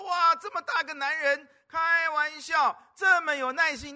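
put-on high, loud impressed old granny; loud, high granny exclaiming in amazement; admiring grandma. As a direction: marvel like a grandma watching. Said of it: "旁边的阿嬷看到也就说".

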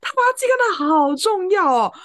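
high, loud incredulous scoff; exasperated. Real, not acted. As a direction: sound fed up with his self-importance. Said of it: "然后我就很不爽".

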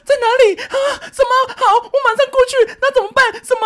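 high, loud mock panic; very high-pitched, hammed-up alarm; mother's panicked phone voice. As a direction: imitate a frantic mum on the phone, gasping questions. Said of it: "然后我妈就说".

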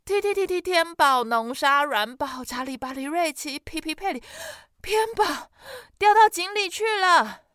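喘着气急急喊出长名字; panting rush. Acted. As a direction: gasp the long name out loud in a rush. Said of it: "他喘着气". (弟弟喘着气急着大喊哥哥的名字)

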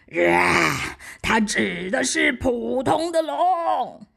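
slow, booming dragon voice; huge beast speaking slowly and loud. Acted.

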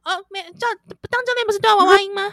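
put-on high, quick baby voice. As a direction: tease in a cute baby voice.

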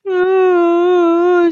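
a tearful whimpering hum; acted whimper. Xixi starting to answer the teacher, tearful whimper. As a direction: whimper before speaking. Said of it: "西西边哭边说".

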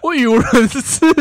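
high, loud giggly admission; laughing. Real, not acted. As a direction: laugh through it, embarrassed. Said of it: "你已经慌到不知道怎么办了".

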